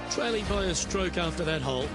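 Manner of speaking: ordinary talk, nothing marked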